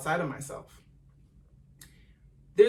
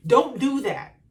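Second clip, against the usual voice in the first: put-on higher, louder parent telling off a child; imitating scolding parent; 7 semitones higher, 8 dB louder, slower than usual. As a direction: scold the kid in the store.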